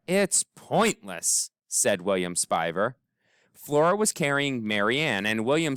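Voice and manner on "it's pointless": slow, gloomy pronouncement. A narrator's reading. William Spiver to the searchers, slow and gloomy. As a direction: drag it out, hopeless.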